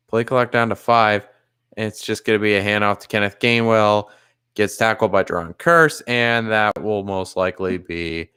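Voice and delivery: low-pitched, measured football commentary; live play-by-play. Real, not acted. Streamer calling football for viewers, low play-by-play. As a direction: call the game evenly, low in the voice.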